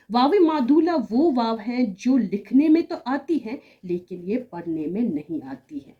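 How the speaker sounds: usual speaking voice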